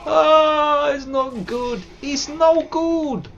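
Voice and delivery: very high, loud squeal of despair; mock squeaky panic. Streamer wailing in a squeaky voice as he loses. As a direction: cry it out like a tiny cartoon victim.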